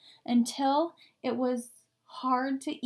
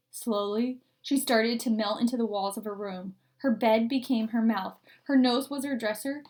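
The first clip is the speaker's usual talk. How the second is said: usual speaking voice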